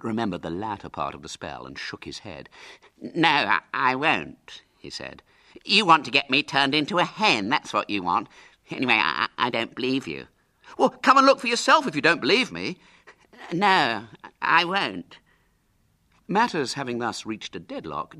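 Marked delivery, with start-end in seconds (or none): 3.0-4.6 raised-voice, faltering refusal; stubborn refusal. A narrator's reading. Hubert refuses the dare. 5.6-10.3 stubborn accusation. A narrator's reading. Hubert argues back. 10.8-12.8 loud, fast, high dare; challenge. A narrator's reading. Ginger repeats the challenge. 13.5-15.2 put-on high, loud boyish refusal; stubborn refusal. A narrator's reading. Hubert refuses louder.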